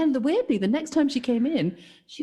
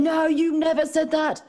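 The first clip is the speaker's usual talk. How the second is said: slow, high, insistent denial; accusing; 5 semitones higher than usual. Retold or imitated. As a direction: insist with indignant emphasis. Customer accusing in argument.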